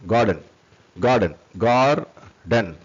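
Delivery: GAR-DEN drawn out in two beats; syllable by syllable. Shown as a demonstration. Teacher shows learners two syllables, syllable by syllable. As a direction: break garden into two slow syllables.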